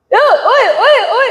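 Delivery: high, loud oi oi shouts; mock angry yell. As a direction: holler at the driver like an annoyed attendant. Angry parking attendant voiced shouting. (teriakan tukang parkir yang marah)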